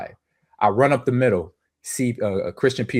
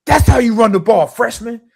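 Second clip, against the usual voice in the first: high, drawn-out roaring imitation; psycho shouting; 11 semitones higher, 8 dB louder than usual. Lineman to freshman after hard run, imitated psycho shout. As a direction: bellow it like a fired-up lineman. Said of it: "That was a psycho".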